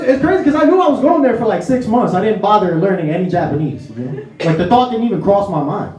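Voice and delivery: ordinary talk, nothing marked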